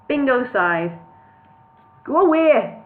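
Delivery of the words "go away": high, loud mock Birmingham call; a shaky try at Brummie, called out; Birmingham accent attempt. A narrator's reading. Bingo calls through the door in a mock Birmingham accent. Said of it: "I can't do a Birmingham accent".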